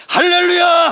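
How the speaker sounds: hallelujah cried out high and loud; a preacher's full-throated shout. Real, not acted. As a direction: rouse the congregation with it.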